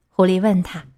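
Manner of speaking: ordinary delivery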